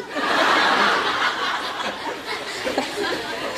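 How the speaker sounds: high wheezing real laughter; hearty laugh. Real, not acted. Laughing at own joke.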